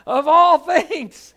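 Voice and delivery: slow, loud words broken by real laughter; can't hold back the laugh at the punchline; laughing through it. Real, not acted. Preacher cracking up at his own refrigerator joke, laughing.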